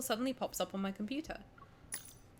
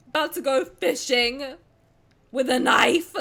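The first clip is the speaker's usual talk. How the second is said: mock menace; 3 semitones higher, 10 dB louder, slower than usual. Menacing joke about fish.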